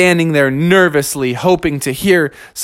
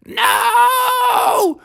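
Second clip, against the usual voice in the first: very high drawn-out wail; long falsetto cry of horror; Mother Bear. Acted. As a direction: squeal it out in dismay. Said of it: "Mother Bear said".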